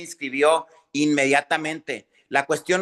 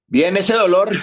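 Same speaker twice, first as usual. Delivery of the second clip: louder, breathless after the reps; out of breath; 2 semitones higher, 7 dB louder than usual. Real, not acted. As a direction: push through the burn. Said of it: "trainer cheering after reps". (sin aliento tras el ejercicio)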